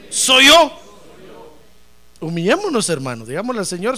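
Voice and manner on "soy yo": high, very loud call for the room to echo; loud repeat-after-me. Real, not acted. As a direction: lead the crowd to shout it back. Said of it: "A ver, diga".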